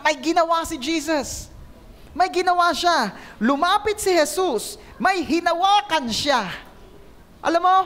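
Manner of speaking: ordinary talk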